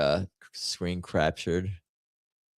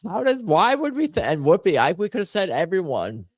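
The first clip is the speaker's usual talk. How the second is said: exasperated high outcry; 11 semitones higher than usual. Real, not acted. Frustrated at missed better answer.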